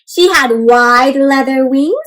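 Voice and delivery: slow, lilting read-aloud voice; story narration. A narrator's reading. Teacher reading a picture book to children, slow and lilting. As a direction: read it slowly to the little ones.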